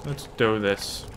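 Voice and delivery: ordinary talk, nothing marked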